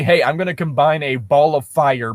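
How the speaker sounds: ordinary talk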